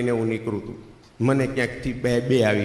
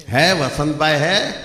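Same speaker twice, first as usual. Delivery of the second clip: slow, raised Hindi call at the door; visitor from Hyderabad; 4 semitones higher, 5 dB louder, slower than usual. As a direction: mimic an out-of-town caller, loud and drawn out. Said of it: "ભાઈ હૈદરાબાદ બાજુથી આવેલો". (હૈદરાબાદી મહેમાનનો લંબાવેલો સાદ)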